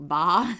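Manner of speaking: imitated goat bleat ba. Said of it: "That was spot on".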